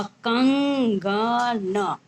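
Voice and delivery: stretched out, syllable by syllable; slow blended word. Shown as a demonstration. Teacher blending letters for students, slowly drawn out. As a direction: draw the word कंगन out long and slow. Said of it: "ग, अ, न, अ".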